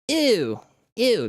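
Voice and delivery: nasal "Ew, ew". Shown as a demonstration. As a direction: pinch it through the nose. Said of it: "it's like when you say".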